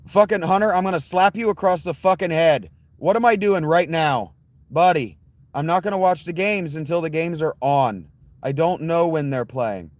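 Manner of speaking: real angry yell, high and loud. Real, not acted. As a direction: yell it in exasperation, easing slightly towards the end. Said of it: "Sorry I yelled at you".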